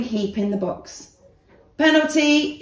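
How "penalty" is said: loud, high call; ref shouting. A narrator's reading. The ref awards a penalty to Danny, loud shout. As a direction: blow the call like a referee. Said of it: "shouted the ref".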